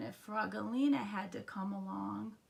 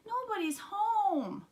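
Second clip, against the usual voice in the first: high, drawn-out whine; crying complaint; 12 semitones higher, slower than usual. A narrator's reading. Froggy telling his mother, high drawn-out whine. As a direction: moan it high and slow. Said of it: "cried Froggy".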